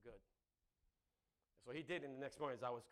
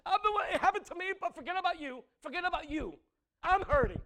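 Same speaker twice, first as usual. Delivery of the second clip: loud, high mock wail with laughter; hammed-up shout; 15 dB louder than usual. Preacher mocking a grieving person's self-pity with a loud laughing shout.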